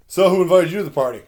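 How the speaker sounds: snide challenge. Retold or imitated. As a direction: throw the question at him like he doesn't belong. Rude guy at party questions him.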